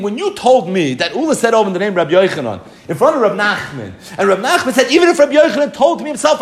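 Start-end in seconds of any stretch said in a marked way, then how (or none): 4.9-6.4 fast, high, laughing scoff; scoffing dismissal. Acted. Retelling Rav Nachman's retort, high laughing scoff. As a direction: brush off the great sage mockingly.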